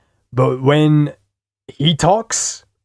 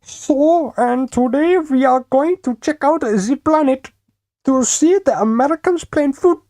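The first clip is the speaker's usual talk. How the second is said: much higher, hammed-up German accent; 13 semitones higher than usual. Shown as a demonstration. Streamer trying out a German accent for chat, hammed-up German accent. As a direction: put on a silly high-pitched German accent. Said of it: "Giving ten a German accent".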